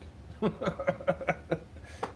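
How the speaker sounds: high, airy run of chuckles; amused laugh. Real, not acted. Laughing after calling someone satanic, high airy chuckle. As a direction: let out a long, breathy chuckle.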